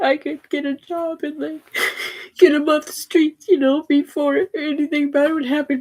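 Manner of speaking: crying through the words; voice breaking. Real, not acted. Breaking down over son.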